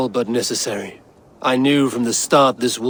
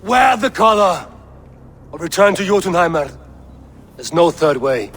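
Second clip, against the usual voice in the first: higher, louder, hard command; stern ultimatum; 6 semitones higher, 5 dB louder than usual. Acted. The master gives the wolf an ultimatum, loud stern command. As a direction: bark the choice at the beast.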